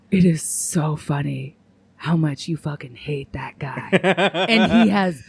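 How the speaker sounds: slow whispered gossip, breaking into a laugh; gossipy whisper. Retold or imitated. Stranger in the bathroom whispering gossip, then laughing. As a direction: lean in and whisper the secret. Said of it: "And she's like".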